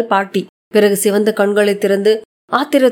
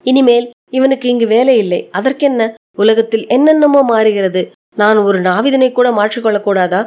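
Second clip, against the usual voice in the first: ordinary delivery, nothing marked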